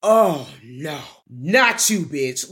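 loud, scornful "oh no, not you"; disgusted brother. Retold or imitated. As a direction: brush off the sister you never wanted back. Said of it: "He's looking at her like".

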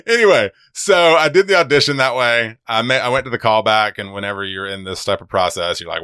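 normal talking voice

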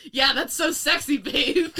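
high, loud, laughing sarcasm; sarcastic laughing. Pretend. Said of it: "racist plantation owner".

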